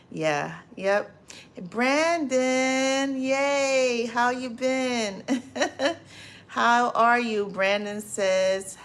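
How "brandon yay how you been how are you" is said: higher-pitched happy hello with a laugh; light up at the name; excited greeting. Real, not acted. Greeting a chat viewer on stream.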